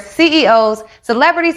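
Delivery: normal talking voice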